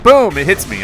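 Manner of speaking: usual speaking voice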